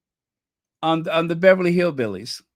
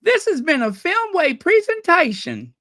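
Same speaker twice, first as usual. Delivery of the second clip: high, louder announcer line; mock last words; 14 semitones higher, 4 dB louder than usual. Joking about an actress's last words, high and bright announcer line. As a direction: chirp it out like a closing credit. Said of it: "Ellie Mae's last words".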